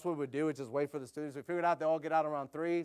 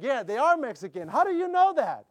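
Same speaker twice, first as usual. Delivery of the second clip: loud, much higher and excited; excited loud reply; 8 semitones higher, 8 dB louder than usual. Retold or imitated. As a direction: fire back the question, thrilled and loud. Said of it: "So I'm speaking in Spanish".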